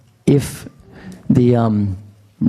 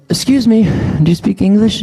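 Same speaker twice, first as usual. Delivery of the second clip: overly polite; 9 semitones higher than usual. Retold or imitated. Polite question to stranger, imitated.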